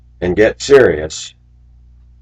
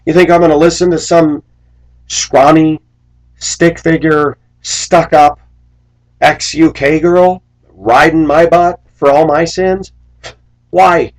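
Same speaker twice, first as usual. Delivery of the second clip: louder, much higher, slow scornful tirade; defiant rant; 9 semitones higher, 6 dB louder than usual. Real, not acted. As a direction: spit out each insult slowly.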